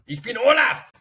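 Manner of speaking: Ich bin Olaf, bien plus aigu et fort; accent allemand. Pretend. Joue le castor allemand. (le castor Olaf, accent allemand très aigu)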